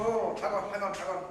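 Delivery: ordinary talk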